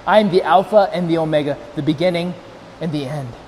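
low, softening divine declaration; solemn scripture reading. A narrator's reading. Preacher reading the words from the throne, solemn. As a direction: let the voice sink down through the verse.

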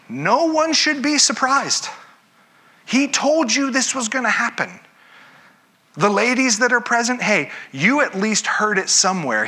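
a lightly hammed scolding angel; exasperated angel. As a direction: chide them like an exasperated messenger. Angel rebuking the women.

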